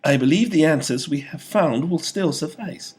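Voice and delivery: high, loud nervous servant voice; a jumpy advisor reassuring his lord; twitchy vizier. A narrator's reading. Vizier nervously reassures the king.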